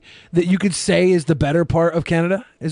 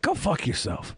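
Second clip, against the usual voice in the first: requested mock insult; 10 dB quieter than usual. Host obliges a donor who asked to be cursed at, softly. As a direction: say it softly, much quieter than usual. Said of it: "tell me to go fuck myself".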